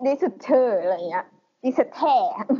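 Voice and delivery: "Dissertation" said slowly with German sounds; German-style reading. Shown as a demonstration. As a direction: repeat "Dissertation" the German way, drawn out. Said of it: "อ่านแบบ Deutsch". (ออกเสียง "Dissertation" แบบเยอรมันช้าๆ)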